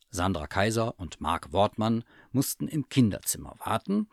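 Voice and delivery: ordinary delivery, nothing marked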